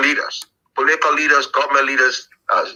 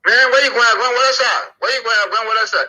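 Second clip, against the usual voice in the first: higher, eager calling out to passing foreigners; local street calling; 9 semitones higher, 4 dB louder than usual. Retold or imitated. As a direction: hail the visitors like a street hawker.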